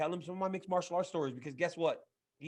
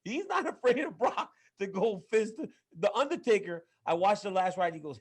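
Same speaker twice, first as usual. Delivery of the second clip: usual speaking voice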